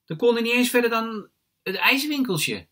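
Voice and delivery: put-on high, loud child voice; child's exclamation. A narrator's reading. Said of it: "zegt Charlie".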